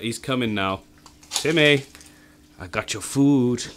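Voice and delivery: ordinary talk, nothing marked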